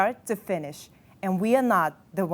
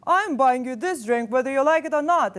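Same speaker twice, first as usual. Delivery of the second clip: mocking domineering quote; 5 semitones higher, 3 dB louder than usual. Accuser voices the man's controlling words.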